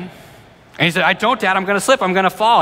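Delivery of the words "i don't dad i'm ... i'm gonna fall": put-on higher, frightened child's pleading; panicking kid. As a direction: play the scared boy sure he'll slip. Son panicking up the tree.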